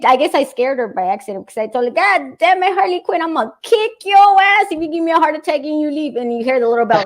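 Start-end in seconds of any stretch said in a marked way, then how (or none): 1.9-6.2 higher mock-threatening scolding; retold exasperated threat at the cat. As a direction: tell off a runaway pet, pitched up.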